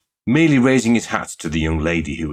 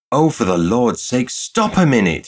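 higher, louder outburst; exasperated cry; 6 semitones higher, 5 dB louder than usual. A narrator's reading. Flustered shop girl stops her suitor, crying out. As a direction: cut him off with a cry. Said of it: "she cried".

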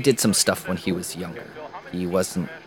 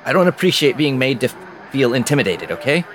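louder, rising protest; defensive outburst; 4 semitones higher, 5 dB louder than usual. Acted. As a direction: push back hard, voice raised. Jim pushes back, rattled.

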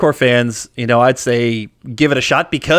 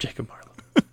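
amused hushed whisper; 10 dB quieter than usual. Real, not acted. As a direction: breathe the name out. Amused repeat of odd name.

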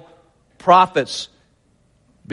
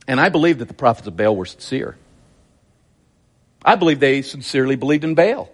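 usual speaking voice

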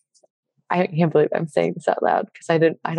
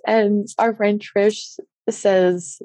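normal talking voice

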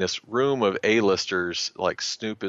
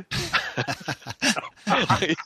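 high-pitched burst of laughter; real, long peal of laughter; 11 semitones higher than usual. Real, not acted. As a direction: laugh hard at the punchline. Punchline of his own story.

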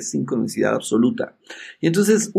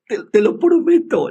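nervous promise; 9 semitones higher, 4 dB louder than usual. Retold or imitated. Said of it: "así todo nervioso".

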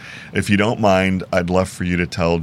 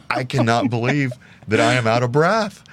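laughing; 10 semitones higher than usual. Retold or imitated. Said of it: "I'm like".

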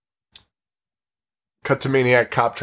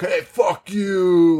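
mock prison yell; 7 semitones higher, much slower than usual. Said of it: "like a typical prison scene".

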